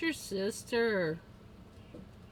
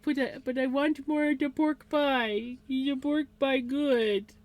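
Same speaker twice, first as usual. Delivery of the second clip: higher, pleading voice for the dog; pet's imagined voice; 5 semitones higher than usual. Pretend. Owner voicing her dog begging for more pork pie, higher pleading voice. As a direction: beg in the dog's voice. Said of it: "He's like".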